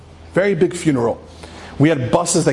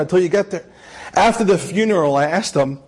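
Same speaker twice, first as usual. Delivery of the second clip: ordinary talk, nothing marked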